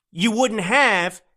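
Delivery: slowed-down build to the point; slow, deliberate. Real, not acted. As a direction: stretch it out for emphasis.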